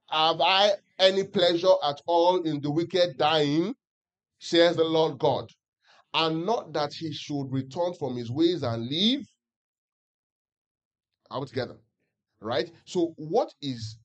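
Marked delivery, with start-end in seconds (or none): 0.1-9.2 slow, louder unison reading, every word spaced; louder group scripture reading. Acted. Preacher leading the church in reading Ezekiel aloud, slow and louder. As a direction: read it out together slowly, in unison. Congregation reads together.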